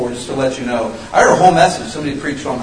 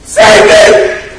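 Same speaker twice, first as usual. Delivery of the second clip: full-force retold scream for rescue; sudden explosive shout, pitched high; desperate scream; 7 semitones higher, 12 dB louder than usual. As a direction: scream it like a drowning man. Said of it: "He screamed".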